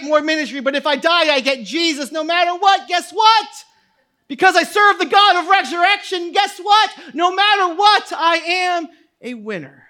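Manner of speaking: impassioned pulpit crescendo; full-force preaching. Real, not acted. Preaching builds to a shout.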